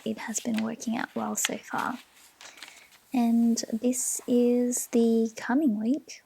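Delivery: normal talking voice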